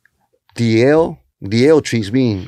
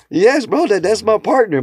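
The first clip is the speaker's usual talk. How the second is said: proud; 8 semitones higher than usual. Retold or imitated. Proudly defending his wife.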